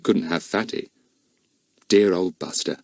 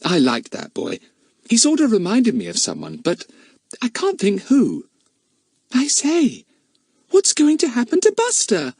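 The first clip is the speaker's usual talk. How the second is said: put-on lightly raised boy's voice, chatty; boy character voice. A narrator's reading. Narrator voicing Larry to his friends, lightly boyish. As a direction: voice young Larry a little higher.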